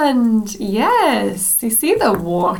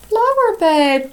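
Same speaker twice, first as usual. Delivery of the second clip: usual speaking voice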